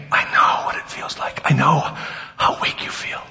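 hushed, whispery comfort; soft, low and breathy, as if consoling the weak; tender reassurance. Acted. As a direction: gently whisper understanding. Voicing Jesus' gentle reassurance.

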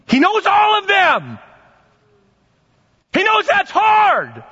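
high, drawn-out exclamation; slow, high-pitched preacher's outburst; impassioned. Real, not acted. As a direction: cry it out high, stretching the key words. Preacher emphatically argues Jesus felt temptation.

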